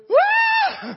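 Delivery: sudden high yelp of fright; a loud startled cry as the floor falls away; mock scream. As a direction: yelp like the plane just dropped. Plane suddenly drops, everyone screams.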